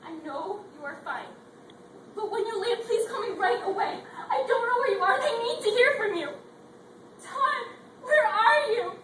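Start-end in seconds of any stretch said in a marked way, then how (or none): 2.2-8.9 rising to a crying shout; growing panic. Acted. Wife pleading on husband's voicemail, panic building to a sobbing shout. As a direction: voice breaking into a desperate cry.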